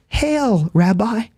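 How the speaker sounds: high, smiling mock greeting, chillingly casual; chilling smiling Judas. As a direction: greet him sweetly like a traitor with a grin. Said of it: "with a big smile and a nonchalance that is chilling".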